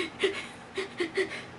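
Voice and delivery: put-on high, breathy baby-like hiccup sob; imitated baby sob. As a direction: mimic a baby's end-of-crying hiccup. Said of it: "you know when babies cry so much".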